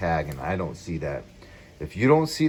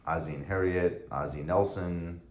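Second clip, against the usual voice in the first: ordinary talk, nothing marked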